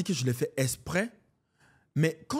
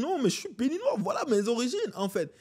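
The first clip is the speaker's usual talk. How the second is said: high-pitched, insistent comeback; imitating a proud refusal, pitched up; retold protest; 8 semitones higher than usual. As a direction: push back firmly, voice lifted high. Retold comedian refusing to change accent.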